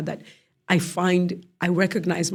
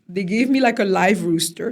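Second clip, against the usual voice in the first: normal talking voice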